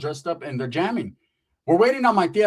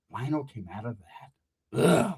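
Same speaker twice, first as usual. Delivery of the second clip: slow, low stunned question; disbelieving echo; 5 semitones lower, 5 dB quieter, much slower than usual. Retold or imitated. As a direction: drag it out in disbelief. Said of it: "I'm like".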